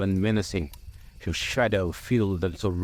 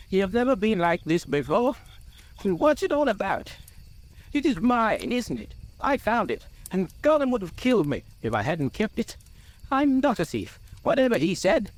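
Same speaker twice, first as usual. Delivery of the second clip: high, agitated hobbit protest; upset Bilbo; 12 semitones higher than usual. A narrator's reading. Bilbo protests he is no thief, agitated. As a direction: plead your innocence, voice pitched high.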